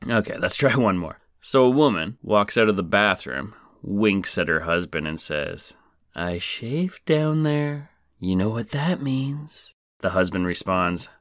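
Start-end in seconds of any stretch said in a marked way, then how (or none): 6.2-9.7 seductive wife. Acted. Wife in joke, suggestive.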